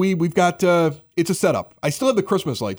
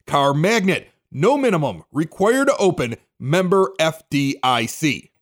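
sponsor ad read; 4 semitones higher than usual. Acted. Host reading the bank's sponsor spot for his listeners, upbeat ad read. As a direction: pitch the bank's junior savers account to listeners.

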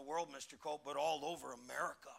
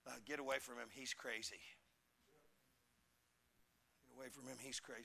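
hushed parents; 3 semitones lower, 10 dB quieter, faster than usual. Retold or imitated. Parents hurrying kids past Noah.

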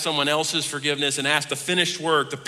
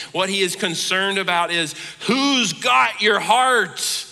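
louder and higher, preaching with rising force; building sermon climax; 5 semitones higher, 6 dB louder than usual. Acted. Pastor rising to full force before his congregation. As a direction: drive it home like a preacher reaching the peak.

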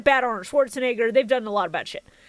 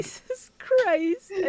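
laughing; 9 semitones higher, much slower than usual. Real, not acted. Laughing helplessly at the joke.

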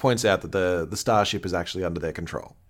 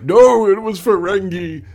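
much higher, loud, slow insistence; super intense; 12 semitones higher, 11 dB louder, slower than usual. Retold or imitated. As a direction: bellow it like a wounded Ferengi. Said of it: "He's, like, super intense about it".

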